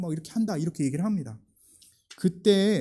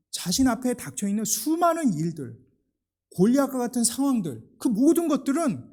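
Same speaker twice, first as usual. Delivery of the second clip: preacher building up, higher and louder; rising sermon; 6 semitones higher, 6 dB louder than usual. Acted. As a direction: lift the congregation with growing conviction. (점점 고조되는 설교)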